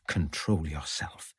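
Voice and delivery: steadying herself quietly and slowly; murmured self-command, slow and soft; under her breath. A narrator's reading. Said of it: "she said under her breath".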